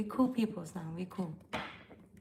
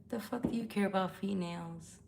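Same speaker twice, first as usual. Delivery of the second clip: ordinary talk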